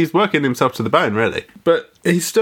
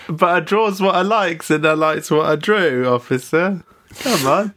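mock Cockney; 5 semitones higher than usual. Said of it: "this legendary bad accent".

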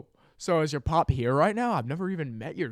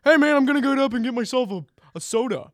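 bro voice; 2 semitones higher, 5 dB louder, faster than usual. Retold or imitated. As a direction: talk like a laid-back dude. Bro voice again.

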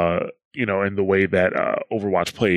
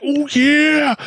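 high gravelly-free "Oh yeah!" shout, much higher and louder; Macho Man Randy Savage; 6 dB louder than usual. Retold or imitated. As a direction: bellow it like a wrestling promo. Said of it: "them saying Macho Man shit".

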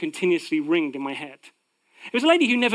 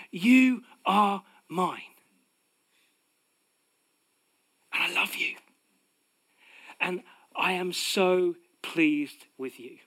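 tender divine voice; slower than usual. Acted. God speaking tenderly to believers.

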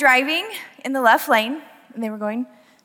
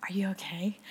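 much softer, lower, kindly asking; a quiet, lower check-in, smiling; gentle question; 6 semitones lower, 14 dB quieter than usual. Retold or imitated. Driver asking the angry woman, soft and smiling. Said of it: "smiling, like".

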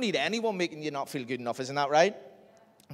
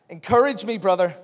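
pleading; 4 semitones higher, 9 dB louder than usual. Retold or imitated. Pleading to mate.

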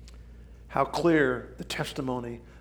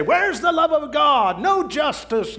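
much higher, louder mock protest; mocking outcry; 12 semitones higher, 5 dB louder than usual. Preacher imitating people crying out at God. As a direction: throw up the complaint. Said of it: "people say".

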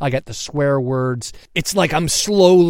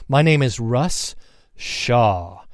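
slow, clear, each word separate; slower than usual. Shown as a demonstration. Host restating his name to listeners, slow and clear. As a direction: spell out the name slowly. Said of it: "I said that really fast".

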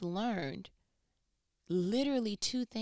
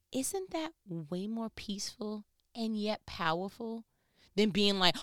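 usual speaking voice

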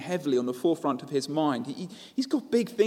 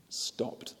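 breathy hushed whisper; 11 dB quieter than usual. A narrator's reading. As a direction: let the word hang in a hush. Dramatic pause on Jesus stopping.

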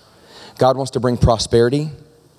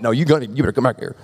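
mock chasing; faster than usual. God chasing the reluctant man.

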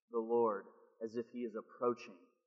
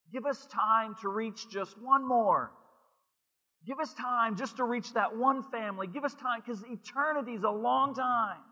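preacher pleading with God for one more family; impassioned preaching; 10 semitones higher, 5 dB louder than usual. Acted. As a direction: beg for more time.

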